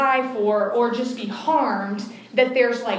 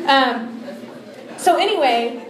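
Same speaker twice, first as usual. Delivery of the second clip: ordinary delivery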